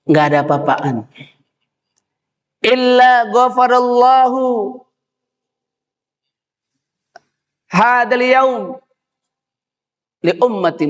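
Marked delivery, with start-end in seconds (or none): 2.7-10.9 Iblis lamenting. Acted. Iblis answering his family about today, in high lament. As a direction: declaim it high and stretched. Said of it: "Apa kata iblis?".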